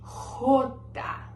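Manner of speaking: rota with a throaty rasping R; rota, R scraped from the back of the throat; Spanish jota. Shown as a demonstration. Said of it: "Eles têm essa dificuldade de pronunciar esse som G".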